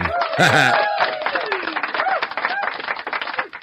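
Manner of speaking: long squeaky high-pitched laugh; high wheezing laugh. Real, not acted. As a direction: burst out in a high cackle. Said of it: "sticks stuck in my head".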